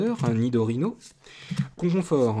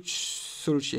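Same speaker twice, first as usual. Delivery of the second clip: Solochi in a light mock Japanese accent, drawn out and falling; a hint of a Japanese accent on Solochi; 4 dB quieter, much slower than usual. Shown as a demonstration. Card opener slips into a light Japanese accent. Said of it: "pourquoi j'ai fait l'accent japonais".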